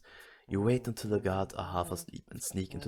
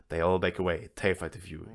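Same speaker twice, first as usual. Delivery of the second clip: usual speaking voice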